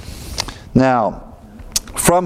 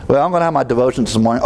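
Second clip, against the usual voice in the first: hurried self-talk; much faster than usual. Pretend. Acting out a busy Monday.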